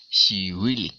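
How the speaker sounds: teacher repeating really for the class; stress on really. Shown as a demonstration. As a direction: hit really hard. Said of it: "the really over there is emphasizing".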